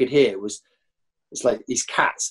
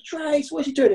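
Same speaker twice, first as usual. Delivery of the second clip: high, puzzled call across the pitch; baffled call. Retold or imitated. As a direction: yell his name in disbelief. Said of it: "I'm going".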